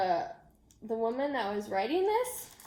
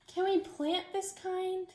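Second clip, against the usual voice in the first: put-on higher and slower, a small child's eager question; little child asking; 9 semitones higher, 4 dB quieter, slower than usual. Child asking teacher to plant seeds, high eager little voice. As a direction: ask it like a little kid hoping for a yes.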